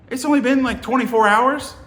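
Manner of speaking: high, loud, disbelieving question; acted incredulity. Visitor learning bugs were just fumed, incredulous. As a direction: push the pitch up in disbelief. Retelling his alarm at the timing.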